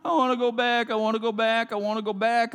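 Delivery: mock whiny plea to go back; lightly voiced complaining crowd; whining Israelites. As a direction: play the grumbling people, childish. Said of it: "and what'd they do?".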